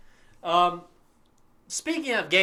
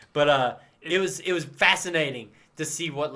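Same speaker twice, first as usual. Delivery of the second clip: ordinary talk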